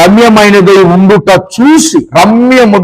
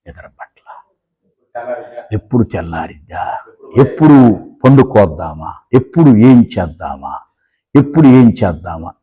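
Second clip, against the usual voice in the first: much lower and softer, a murmured whisper; restless sleepless Eve; 12 semitones lower, 6 dB quieter than usual. Acted. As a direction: voice Eve tossing and turning in the night, muttering to herself. Eve voiced, sleepless at night.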